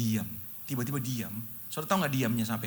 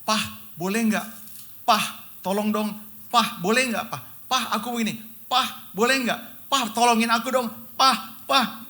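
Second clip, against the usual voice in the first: imitating a small child pleading, higher and louder; mock whiny plea to Papa; child begging papa; 8 semitones higher, 6 dB louder than usual. Preacher imitates his little girl begging her papa.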